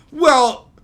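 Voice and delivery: loud, high, smug mock explanation; mock smug answer. Mocking the writers' excuse, smug and loud. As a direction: sell a silly excuse with fake confidence.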